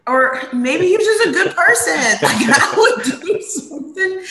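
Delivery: high-pitched, laughing objection; laughing protest. Real, not acted. Answering co-host's joke about the man, laughing protest. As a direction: protest through real laughter, voice shooting up.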